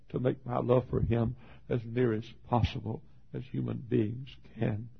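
normal talking voice

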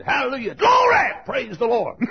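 jubilant shouts of praise; high cries, peaking loud in the middle; Billy Bray. Acted. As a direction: shout it out joyfully. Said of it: "Billy Bray always shouted".

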